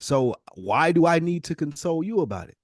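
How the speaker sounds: usual speaking voice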